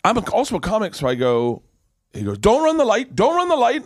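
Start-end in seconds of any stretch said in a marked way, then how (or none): 2.4-3.8 much higher and louder, repeated mock order; hammed-up nagging host; bossy warning. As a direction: imitate a condescending host warning the new guy. Host bossing him about time.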